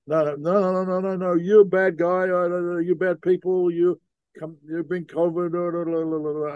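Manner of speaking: a lightly voiced old man waving them off; ranting local.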